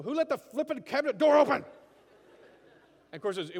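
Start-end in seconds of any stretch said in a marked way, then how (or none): 0.0-1.6 loud, high indignant yell; hammed-up furious outburst after hitting your head; angry shout. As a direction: blame the world at the top of your voice. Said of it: "But instead we go".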